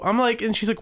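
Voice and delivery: normal talking voice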